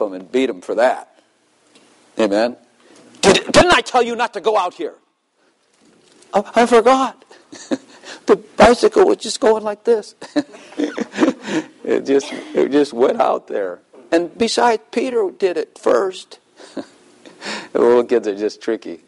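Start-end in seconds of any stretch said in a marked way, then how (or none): 3.2-4.9 high, mock-scolding parent voice; hammed-up parent scolding the kid. 6.3-7.2 high, mock child's excuse; hammed-up child voice; kid's excuse. 8.3-10.1 high, mock child's excuse; hammed-up child voice; kid's excuse. 14.1-16.4 high, slow mock-child whine; hammed-up child voice; kid's excuse.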